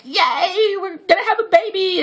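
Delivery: high, squealing joy; giddy high-pitched cheer; excited squeal. Retold or imitated. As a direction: squeal it like you just found out. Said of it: "And I'm like".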